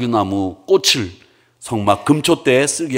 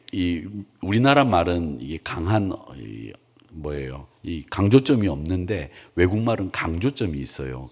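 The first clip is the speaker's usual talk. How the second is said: ordinary talk, nothing marked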